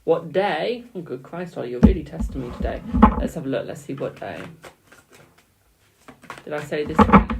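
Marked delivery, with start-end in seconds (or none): 3.7-4.4 low murmur. Real, not acted. Reader checking the cards for the day, a low murmur. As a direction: lower and softer, musing aloud.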